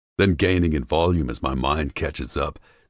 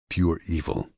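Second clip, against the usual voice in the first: plain reading voice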